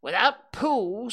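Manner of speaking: high, slow pronouncement; drawn-out, weighty announcement; solemn. A narrator's reading. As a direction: declare it gravely. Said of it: "said Rabbit solemnly".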